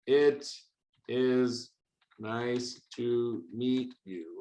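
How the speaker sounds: slow, low robot greeting; word by word, like a computer. Pretend. Teacher typing the computer's greeting to the user, slow computer voice. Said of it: "That sounds pretty computery".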